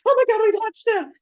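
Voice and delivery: high, loud fangirl squeal; excited scream. Retold or imitated. Two friends leaving the photo, shrieking with excitement. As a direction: scream it like two fans who just met their idol. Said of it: "grabbed each other's hands and screamed".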